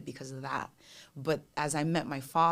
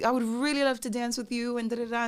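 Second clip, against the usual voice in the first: high, louder pleading; eager fan request; 7 semitones higher, 5 dB louder than usual. Acted. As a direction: pitch it up and push the request. Fan pleading, retold again.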